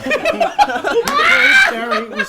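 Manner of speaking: reading slowly, high and loud through laughter; can barely get the words out for laughing; words squeezed out between laughs. Real, not acted. Laughing while reading erotica.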